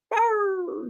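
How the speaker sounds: high cartoon howl; imitated howl. Host imitating Rocket in the cartoon, high howl. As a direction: mimic an animal howling as it runs.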